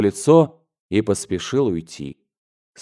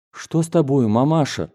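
put-on higher-pitched, anxious child's question; concerned question; 5 semitones higher than usual. A narrator's reading. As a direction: ask mother with raised pitch and concern. Worried son questions tearful mother.

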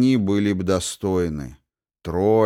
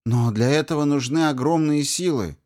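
excited; 7 semitones higher than usual. Acted. Gelera objects to the swords.

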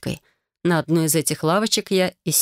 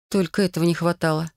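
plain reading voice

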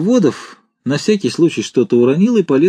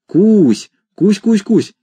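high, gentle pet call; coaxing call; 9 semitones higher, 3 dB louder, much slower than usual. A narrator's reading. Commandant calls the shy pterodactyl, sweet and high. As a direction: keep coaxing, pitched up. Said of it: "ласково позвал".

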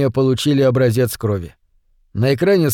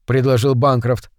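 plain reading voice

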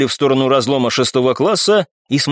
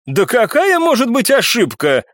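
higher-pitched outcry of disbelief; indignant exclamation; 9 semitones higher than usual. A narrator's reading. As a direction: cry out, pitch jumping up. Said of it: "ещё громче вскричал Данцев".

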